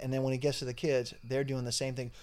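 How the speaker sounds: ordinary talk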